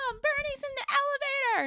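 put-on very high kid's alarmed call; imitating child yelling. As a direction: shriek it like a tattling kid. Daughter yells at mom.

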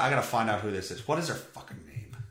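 ordinary delivery

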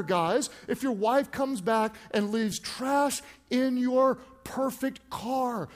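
ordinary talk, nothing marked

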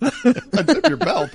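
slow repeat broken by laughter; laughing. Real, not acted. Cohost repeating the unzip joke while cracking up, laughing. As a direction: laugh through the words, drawn out.

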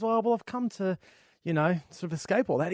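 high, hesitant put-on voice; reluctant bloke. Hermit bloke hedging to the cowboy, high put-on voice. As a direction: hedge politely, wanting to be left alone. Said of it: "And the guy says".

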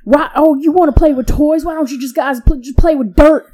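loud, high cranky hillbilly elder; Hillbilly Steve. Retold or imitated. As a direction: grumble like a cranky country old-timer. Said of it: "my elder voice ... Hillbilly Steve".

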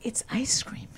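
whispered confession; whispering. Real, not acted. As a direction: whisper it like a secret. Confessing her guilty pleasure.

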